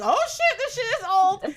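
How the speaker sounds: high-pitched comic astonishment, laughter creeping in; amazed outburst. Retold or imitated. Book dealer sees the ancient find, amazed. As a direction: blurt it out in mock amazement.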